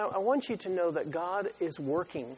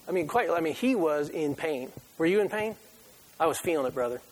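ordinary delivery

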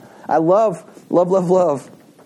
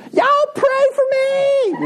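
put-on very high, drawn-out Southern kid cry; little boy's Southern accent; much slower than usual. Little boy carried out the church door, high Southern plea. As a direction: wail it slowly in a high Southern drawl. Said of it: "in the great little Southern accent goes".

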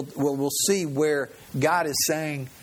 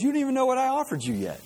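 salesman retort; 11 semitones higher, faster than usual. Retold or imitated.

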